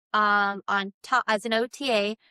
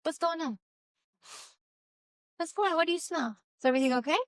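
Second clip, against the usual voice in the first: pet talk; 5 semitones higher, faster than usual. Real, not acted. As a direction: ask the dog gently. Talking to her dog.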